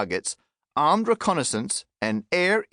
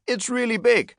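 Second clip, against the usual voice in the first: mock shout; 9 semitones higher, 3 dB louder than usual. On BBC News explaining a trillion, a mock shout. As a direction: pitch jumps much higher, a shouted burst. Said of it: "Apparently shouting".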